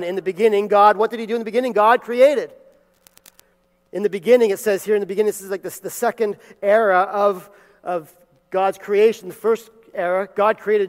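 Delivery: preacher building to a loud climax; preaching at full force. Acted. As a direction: drive home the second era of creation. Preaches on Genesis with rising force.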